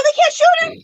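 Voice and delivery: high-pitched protest in mock panic; retold freak-out. Protesting to dispatcher on retold call, high panic. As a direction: object in a dramatized high cry. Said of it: "why I was freaking out".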